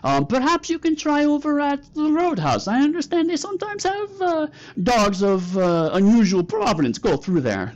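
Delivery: high, polite fake Korat accent; hammed-up Korat accent, climbing high; Mister Meow. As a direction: deflect the officers in a put-on Korat accent. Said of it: "fake Korat accent you've ever heard".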